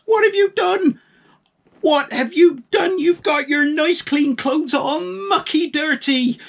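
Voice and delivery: very high, loud scolding shriek; furious wife. A narrator's reading. Missus Farmer Palmer furious at her husband's dirty clothes, loud high scolding. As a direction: scold him for the mucky clothes.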